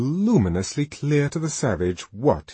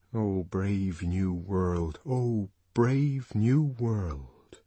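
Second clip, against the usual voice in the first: soft, very slow wonder; awed murmur; 4 dB quieter, much slower than usual. A narrator's reading. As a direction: breathe it in awe. Savage hears words in mind.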